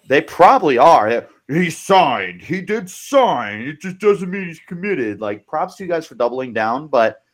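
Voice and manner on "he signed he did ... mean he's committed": higher, sing-song voice of a fan in denial; imitating a fan who still won't give up; mock Mizzou fan. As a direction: play the stubborn fan clinging to hope. Mocking Mizzou fans' denial.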